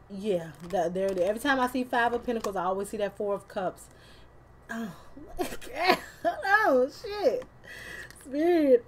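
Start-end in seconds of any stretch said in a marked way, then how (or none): 4.7-8.8 laughing surprise. Real, not acted.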